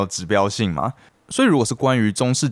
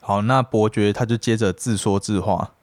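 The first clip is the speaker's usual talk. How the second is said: normal talking voice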